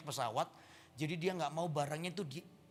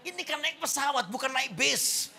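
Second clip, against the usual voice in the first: loud, high exasperated outburst; 8 semitones higher, 11 dB louder than usual. Acted. Comedian blowing up at nosy seatmate, exasperated. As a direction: shout back at a pointless question, much higher. Exasperated reply to a silly question.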